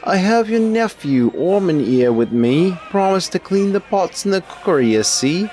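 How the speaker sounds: very high, loud put-on cook; cook's accented voice. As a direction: pipe up as a cook in a wandering accent. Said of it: "I don't know what accent that was turning into".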